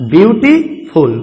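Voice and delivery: ब्यूटीफुल slowly, as ब्यूटी, short break, फुल; split into syllables. Shown as a demonstration. Teacher counting two syllables for students, ब्यूटीफुल slowly split in two. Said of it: "इसमें two syllable हैं".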